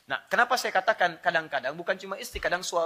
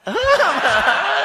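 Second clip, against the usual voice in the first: wife's laugh; 12 semitones higher, 11 dB louder than usual. Retold or imitated. Wife laughing in the story.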